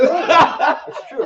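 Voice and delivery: high, loud laughing agreement. Real, not acted.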